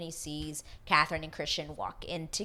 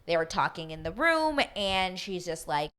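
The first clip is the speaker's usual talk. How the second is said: ordinary delivery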